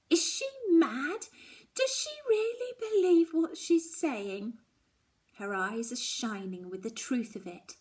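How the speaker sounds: distraught disbelief. Acted. Daughter in shock.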